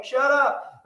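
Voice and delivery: loud, high cat retort; translated cat voice. Retold or imitated. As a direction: snap back as a fed-up cat. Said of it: "that's what cats always say".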